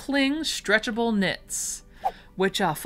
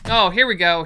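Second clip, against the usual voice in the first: ordinary talk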